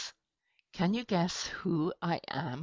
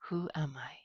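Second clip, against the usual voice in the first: secretive riddle whisper; hushed whisper to the kids; 7 dB quieter, faster than usual. Acted. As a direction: breathe the question like a secret. Riddle question to children.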